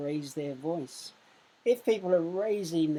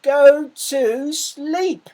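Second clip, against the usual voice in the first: high, loud, very slow big-mouse yell; a fed-up Big Mouse yelling at Little Mouse; exasperated shout; 9 semitones higher, 14 dB louder, much slower than usual. Shown as a demonstration. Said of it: "He actually said".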